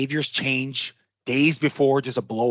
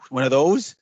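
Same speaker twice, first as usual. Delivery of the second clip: ordinary talk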